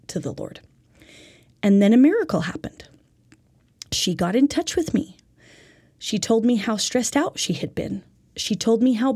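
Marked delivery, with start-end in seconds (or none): none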